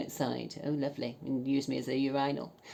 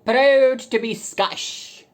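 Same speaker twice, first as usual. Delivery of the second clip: loud, high, very slow chant; mock proclamation; 11 semitones higher, 13 dB louder, much slower than usual. Mocking the man's profile, loud slow proclamation. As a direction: belt it out like a proud anthem.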